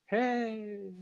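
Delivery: soft, high, drawn-out faraway call; hushed mock holler from a long way off; imitating distant yell. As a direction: mimic a shout barely heard from far above. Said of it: "He must have been yelling".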